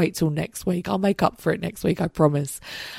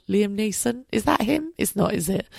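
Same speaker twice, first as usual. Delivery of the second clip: ordinary talk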